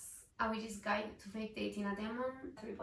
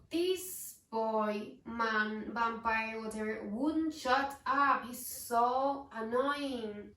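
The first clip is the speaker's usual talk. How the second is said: louder, fed-up rant that slows down at the end; louder, exasperated complaint, last words dragged out slowly; 7 dB louder, much slower than usual. Real, not acted. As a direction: vent like he drove you up the wall. Ranting about annoying vampire narrator.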